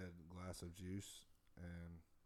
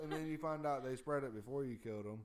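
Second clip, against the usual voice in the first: loud arguing; 7 semitones higher, 7 dB louder than usual. Real, not acted. Arguing back loudly.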